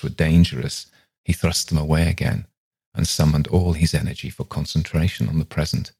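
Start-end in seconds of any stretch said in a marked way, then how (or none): none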